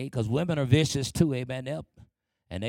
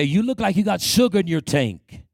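higher, louder jeer; mocking; 5 semitones higher, 6 dB louder than usual. Kids mocking the boy, higher, louder jeer. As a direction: sneer it like a bully. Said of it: "They say".

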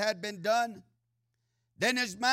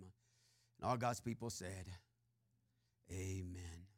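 hushed, reverent prayer to close the sermon; soft closing prayer; 10 semitones lower, 8 dB quieter than usual. Acted. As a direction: pray it low and gentle, almost under the breath. Closing prayer after the reading.

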